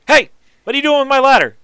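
high, loud, fast yell at a thief on the ladder; angry shout. Acted. Artisan yelling up at the stranger on his ladder, high and loud. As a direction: shout it like a man catching a thief.

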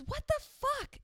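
mock the girl's shrill outburst; shrieked outrage. The shoplifter finds the shoes tagged and screams in outrage.

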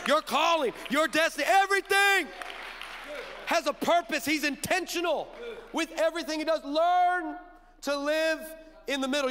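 loud, high fervent preaching. Real, not acted. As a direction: build to a fervent shout. Preaching at full force.

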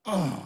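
loud, high pained groan; acting out an involuntary groan. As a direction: groan as if your stomach hurts. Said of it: "groaning, like".

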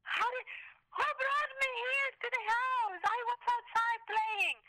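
high, slow, bewildered repeated question; dazed girl. Retold or imitated. Mother imitating her daughter's confused asking. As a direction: repeat it like a lost concussed kid.

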